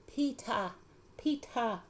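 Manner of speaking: "Peeta" called high with an open -ah ending; toddler pronunciation. A narrator's reading. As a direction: call "Peeta" like a little boy, dropping the r of Peter. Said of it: "That's how he says my name".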